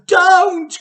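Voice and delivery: piercing very high, very loud cry; a desperate shriek; Missus Cranky crying out. A narrator's reading. Missus Cranky cries out to stop Grandma. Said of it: "cried Missus Cranky".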